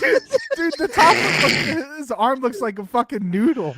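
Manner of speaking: high, loud, laughing exclamation; hysterical disbelief. Real, not acted. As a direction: blurt it out while cracking up, pitch way up.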